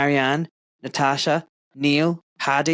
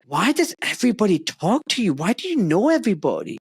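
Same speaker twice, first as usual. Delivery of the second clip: daughter's voice; 7 semitones higher than usual. Retold or imitated. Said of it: "she's like".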